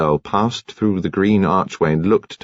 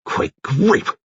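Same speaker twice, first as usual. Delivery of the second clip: sharp higher outcry of shock; cried out; 10 semitones higher than usual. Acted. Commander startled by mention of the cat, crying out. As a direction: blurt it out, stung. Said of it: "Seiss cried out".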